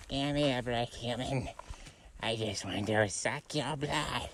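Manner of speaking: high squeaky mosquito pleading. Pretend. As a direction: plead like a tiny bloodsucker. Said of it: "It's like".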